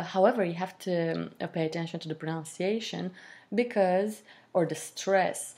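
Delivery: ordinary talk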